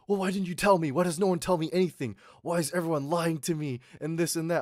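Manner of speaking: imitating shouting brother. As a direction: act out an aggrieved brother's angry complaint. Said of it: "he was shouting at my mom".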